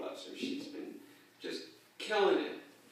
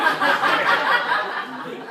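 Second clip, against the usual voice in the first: high, breathless real laugh; helpless laughing fit; burst of laughter; 15 dB louder than usual. Real, not acted. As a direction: crack up mid-story. Laughing at grandma's stunt.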